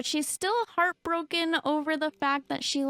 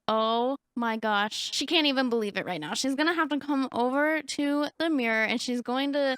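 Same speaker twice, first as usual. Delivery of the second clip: usual speaking voice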